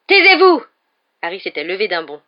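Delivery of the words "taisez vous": high, very loud furious cry; furious shout. A narrator's reading. Harry leaps up and yells at Snape in fury. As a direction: explode at him with rage. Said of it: "Une rage telle".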